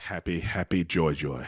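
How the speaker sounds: Ren and Stimpy. Retold or imitated. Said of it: "That's a deep Ren and Stimpy quote".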